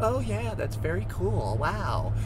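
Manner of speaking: high mock polite enthusiasm; fake interest. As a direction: fake being impressed. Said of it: "They're like".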